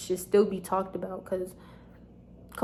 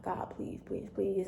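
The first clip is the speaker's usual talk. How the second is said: whispered prayer; 9 dB quieter, slower than usual. Retold or imitated. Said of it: "I just kept praying and praying".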